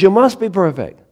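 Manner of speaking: loud preached demand with "must" stressed; emphatic demand. Acted. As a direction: hit "must" hard like a command.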